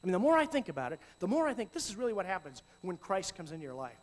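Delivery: ordinary talk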